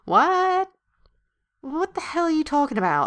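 high-pitched, offended outburst; indignant shock. Acted. Girl answers doubts about being a lady, indignant shock. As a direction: leap up high in disbelief.

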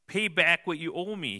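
loud, high shouted demand; harsh demand. Acted. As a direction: bark it at the debtor. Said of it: "he demanded".

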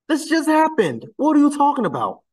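very high, exasperated disbelief; incredulous. Real, not acted. Answering commenters who criticised a recent wedding, incredulous. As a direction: throw it back at them in a high voice.

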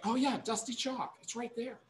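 high, soft mock agreement; small high voice, teasingly knowing; imagined agreeing audience. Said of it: "I know you're all saying that".

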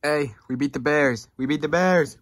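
usual speaking voice

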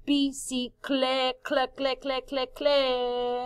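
"Bicicle" drawn out, sticking on "cle, cle, cle"; isolated syllable repeated. Shown as a demonstration. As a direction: chant "cle" over and over on one level pitch. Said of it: "¿Dónde está la combinación consonántica?". ("cle, cle, cle" repetido como disco rayado)

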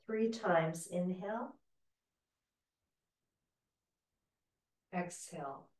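soft, fading breath cues. Shown as a demonstration. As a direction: breathe them gently down.

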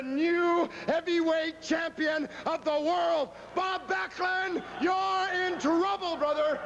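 slow, drawn-out bellowed boast; promo shout. Acted. Wrestler threatening his title rival, slow booming shout. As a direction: roar it out to the cameras like a champ.

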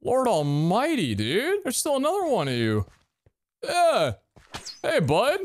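high-pitched, loud disbelief; alarmed exclaiming. Real, not acted. Still facing another enemy in game.

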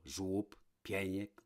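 usual speaking voice

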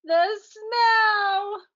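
high crying wail. Acted. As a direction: wail it as he collapses. Boy falling to his knees.

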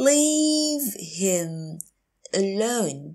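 long "Leave him", pause, then "alone"; slow teacher model of "Leave him alone"; slow, broken up. Shown as a demonstration. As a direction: stretch "Leave" and separate "alone". Teacher repeats phrase slowly.

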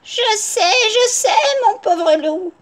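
high, slow, sighing granny; sighed sympathy. A narrator's reading. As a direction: commiserate with a long high sigh. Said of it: "soupira la grand-mère".

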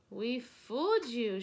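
loud gleeful shout. A narrator's reading. Monkeys gleefully shouting at Lulu, loud. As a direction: crow it like tricksters. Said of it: "shouted the monkeys".

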